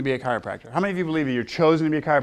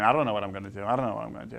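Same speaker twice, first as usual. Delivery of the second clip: lower, mock mopey teen drone; mimicking kids; 5 semitones lower, 5 dB quieter, much faster than usual. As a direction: whine like a bored teen. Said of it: "they're always saying".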